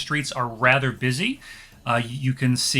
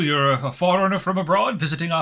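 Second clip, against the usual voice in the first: fawning merchant; 3 semitones higher than usual. Acted. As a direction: flatter the stranger in a lifted pitch.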